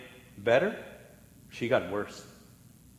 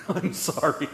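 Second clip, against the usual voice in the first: giggling sorry after a dark joke; laughing apology; 4 dB quieter than usual. Real, not acted. Pastor to congregation, laughing apology. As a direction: apologize through a soft laugh.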